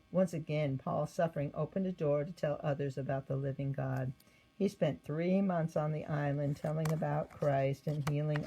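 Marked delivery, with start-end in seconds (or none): none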